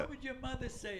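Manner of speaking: high, soft tearful-aunt imitation; a touch of hammed-up weeping; mock crying. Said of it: "she's crying".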